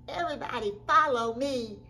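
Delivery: loud, high storybook call; rallying call. Acted. Character calls everybody to follow, rallying.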